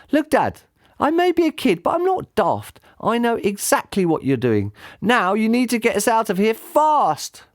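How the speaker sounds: put-on high child's voice, firm; a kid telling his dad off; scolding boy. A narrator's reading. Son tells dad he knows the truth, high and firm.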